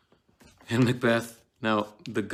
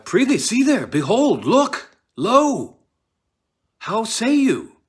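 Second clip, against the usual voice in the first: high, loud, panicked cries at the empty chair; terrified shouting; 14 dB louder, slower than usual. Acted. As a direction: scream it as if the ghost is right there. Macbeth shows the ghost, terrified.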